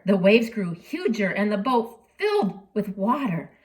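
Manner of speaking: usual speaking voice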